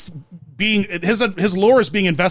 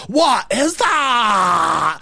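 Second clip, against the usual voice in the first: put-on very slow, drawn-out Scottish toddler accent; Scottish baby; 2 semitones higher, much slower than usual. Son showing mom the toddler's line, drawn-out Scottish accent. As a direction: stretch every vowel like a sassy Scottish baby. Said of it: "they love this little Scottish baby".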